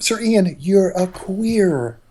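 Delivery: high, drawn-out mock scolding. Quotes someone addressing Sir Ian.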